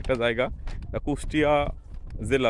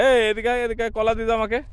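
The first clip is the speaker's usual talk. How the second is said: high calling shout; yelling across the room; 8 semitones higher than usual. Real, not acted. Calling child across to him.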